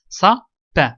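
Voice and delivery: "Ça, pain" découpé en deux syllabes séparées; détache nettement "Ça", pause, puis "pain"; syllabe par syllabe. Shown as a demonstration. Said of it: "la première ou bien la deuxième". ("Ça... pain", mot coupé en syllabes pour l'élève)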